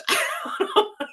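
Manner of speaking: high delighted burst of laughter; real laugh. Real, not acted. Remembering her favorite shirt, a high delighted laugh. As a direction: let a happy laugh escape. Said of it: "and I started to chuckle".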